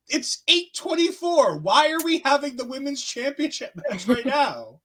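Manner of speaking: she can't believe the match is on now; incredulous. Retold or imitated. Retold confusion at match timing.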